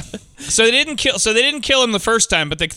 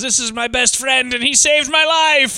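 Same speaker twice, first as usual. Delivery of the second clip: imitated party toast; 7 semitones higher than usual. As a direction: proclaim it to the whole party, hammed up. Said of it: "he at a party says".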